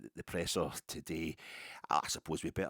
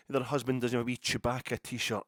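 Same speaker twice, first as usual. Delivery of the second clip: ordinary talk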